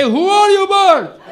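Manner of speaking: high, loud mock bear shout. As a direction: bellow as a cartoon bear. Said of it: "Bear say".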